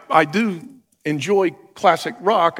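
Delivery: ordinary talk, nothing marked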